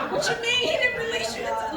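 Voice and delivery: high, laughing disbelief; incredulous laugh. Retold or imitated. Answering her pastor, a high laughing mock disbelief.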